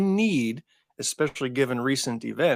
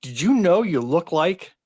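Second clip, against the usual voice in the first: higher, louder eager quote; excited question; 5 semitones higher, 4 dB louder than usual. Retold or imitated. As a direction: blurt it out like a fan. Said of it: "and say".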